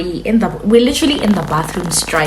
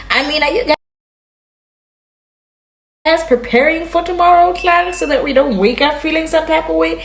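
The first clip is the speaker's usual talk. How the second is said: baffled rambling questions; 7 semitones higher than usual. Retold or imitated. Confused drunk questioning.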